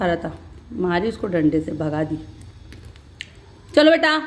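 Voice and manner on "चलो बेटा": bright, much higher and louder goodbye call; loud sign-off. Real, not acted. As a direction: call out the farewell to the viewers. Vlog sign-off to viewers. (ऊँची आवाज़ में विदाई)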